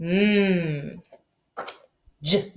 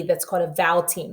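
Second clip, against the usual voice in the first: ordinary talk, nothing marked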